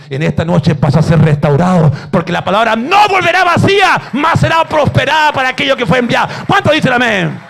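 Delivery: loud, impassioned preaching; full-force preaching. Acted. Pastor declaring the promise to the congregation, loud. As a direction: thunder it from the pulpit.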